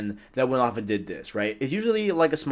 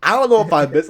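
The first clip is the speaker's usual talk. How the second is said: high, loud mock alarm; acted-out, raised-voice reaction; startled protest; 7 semitones higher, 5 dB louder, faster than usual. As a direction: blurt it like a startled objection. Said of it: "I'm like, "Wait".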